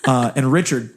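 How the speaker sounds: ordinary delivery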